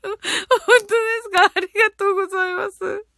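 teary laugh, voice breaking up high; laugh-cry the thanks; laughing through tears. Real, not acted. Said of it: "泣き笑い".

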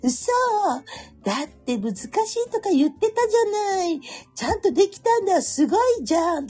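put-on high child voice in crisp Tokyo speech; Tokyo schoolkids. Schoolchildren chatting about homework, high child voice in Tokyo speech. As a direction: play a chatty first grader. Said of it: "東京の言葉喋るんですよ".

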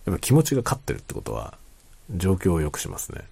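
normal talking voice

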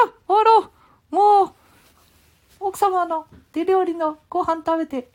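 put-on very high squeaky child voice; high boy puppet butting in; Ken-chan puppet voice. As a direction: pipe up high like a cheeky little boy.

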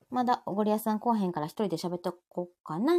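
normal talking voice

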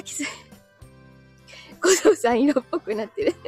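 ordinary delivery

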